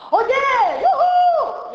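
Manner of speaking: very high, loud call, whole body behind it; high shout. Shown as a demonstration. Flamenco teacher demonstrating a high call to her class, shouted. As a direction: yell it out high like calling across a street. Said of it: "por ejemplo".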